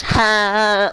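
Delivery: sharp high mock laugh; a single high fake laugh. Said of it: "You didn't even laugh".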